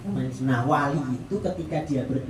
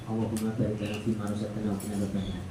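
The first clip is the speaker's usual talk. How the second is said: quick recited prayer; 6 semitones lower, 7 dB quieter, faster than usual. Shown as a demonstration. Recites the prayer before eating.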